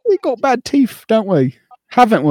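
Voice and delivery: high mock Cockney lilt; mock British accent. Mocking British stereotype.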